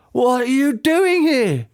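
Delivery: slow, high demanding father's voice; lightly voiced dad, demanding. A narrator's reading. Dad demands why his son is here, slow and high. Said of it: "the man demanded".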